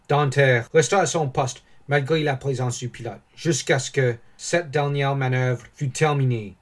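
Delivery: ordinary delivery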